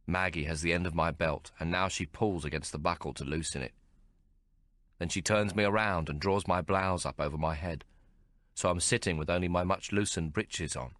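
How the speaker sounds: ordinary delivery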